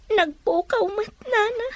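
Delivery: sobbing out the last words to her mother; crying. Acted. Daughter grieving missing Romy. (agsangsangit nga agsao)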